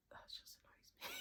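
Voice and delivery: whispered aside; a hushed, annoyed whisper. Real, not acted. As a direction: breathe it as a quiet secret. Aside about Jace's hopes.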